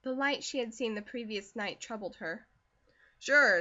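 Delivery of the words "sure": said largely. A narrator's reading. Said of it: "said Wesley largely".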